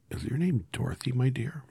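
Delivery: high, lightly voiced old woman. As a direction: ask kindly as a little old woman. Old woman asks Dorothy.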